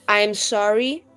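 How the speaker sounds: usual speaking voice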